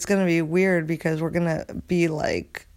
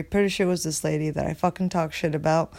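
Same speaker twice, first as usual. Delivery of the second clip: ordinary delivery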